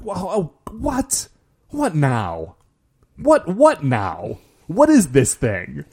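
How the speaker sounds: hammed-up villains in disbelief; rising, exasperated string of questions; mock upset. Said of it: "they're so upset".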